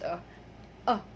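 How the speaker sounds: a soft, short "uh" for a; a as a low, quiet, indistinct vowel; schwa sound. Shown as a demonstration. Teacher reminding learners of the schwa sound. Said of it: "Not really a distinct vowel sound".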